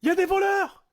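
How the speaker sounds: high, loud cry of alarm; shouted alarm. Retold or imitated. Woken by masked men, he cries out loud. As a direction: yell out that there are thieves. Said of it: "je crie quoi".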